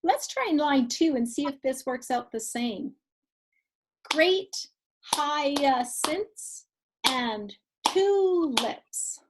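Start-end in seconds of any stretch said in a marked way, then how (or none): 4.1-9.2 slow, one beat per syllable; clapped syllables. Shown as a demonstration. Teacher to class, slow syllable-by-syllable clapping. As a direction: stretch out every syllable with claps. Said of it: "We'll show you".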